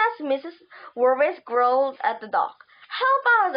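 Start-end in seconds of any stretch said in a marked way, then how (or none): 2.9-3.6 high, raised cry for help; shouted plea. A narrator's reading. Runner shouting for help to a man ahead, shouted. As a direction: call out higher, short of a full shout. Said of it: "I shouted".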